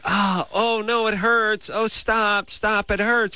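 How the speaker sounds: high, drawn-out fake cries of pain; mock pleading. Host playing a dominatrix's client who only pretends to protest, mock pleading. Acts out fake pleading during safe-word play.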